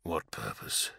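low, very soft mumble; mumbling. A narrator's reading. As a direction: murmur it, lost in thought. Dazed follow-up to mumble.

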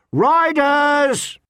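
high shrieked warning from the ridge. A narrator's reading. Lookout on the ridge shrieks warning to camp, high shriek. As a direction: scream it out as a lookout. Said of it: "The shriek came from".

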